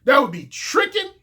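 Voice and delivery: ordinary talk, nothing marked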